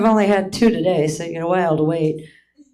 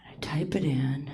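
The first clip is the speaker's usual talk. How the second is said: secret whisper; 5 semitones lower, 11 dB quieter than usual. Retold or imitated. Sneaking phone search.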